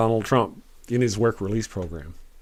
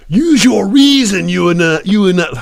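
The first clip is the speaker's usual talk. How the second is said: movie-line impression; 7 semitones higher, 8 dB louder than usual. Streamer fumbling a famous movie line, hammed-up shout. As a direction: bellow it like a film hero, then falter. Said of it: "You've seen the movie".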